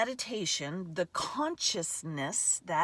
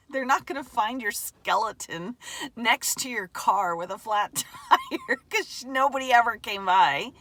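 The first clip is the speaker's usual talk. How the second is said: laughing; 2 semitones higher, 8 dB louder than usual. Real, not acted. Laughing at own grim joke.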